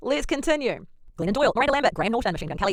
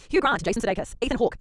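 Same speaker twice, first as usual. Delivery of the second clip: normal talking voice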